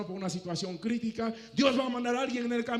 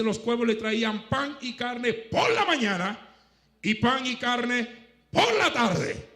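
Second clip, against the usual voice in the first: slow, louder, building to the end; scripture reading; 5 semitones higher, 5 dB louder, slower than usual. Acted. Preacher reading the verse to the congregation, slow and emphatic. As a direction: read the verse out slowly with weight. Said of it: "Mira lo que dice claramente".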